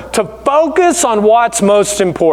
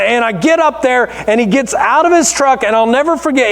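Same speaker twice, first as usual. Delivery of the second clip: usual speaking voice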